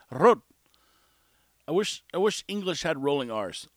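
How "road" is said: "Road" loud, with a trilled R; imitated pronunciation. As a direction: relish the rolled R in "Road". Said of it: "I love the way he says".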